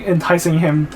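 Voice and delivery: normal talking voice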